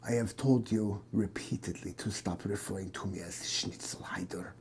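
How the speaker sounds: put-on whispered German accent. German doctor whispering to the detective, in a German accent. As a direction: hiss the complaint in a German accent. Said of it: "Your stupid accent".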